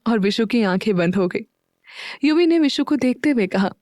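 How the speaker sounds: ordinary delivery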